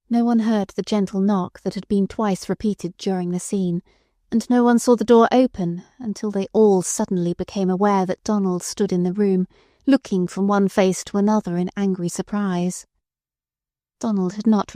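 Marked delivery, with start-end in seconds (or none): none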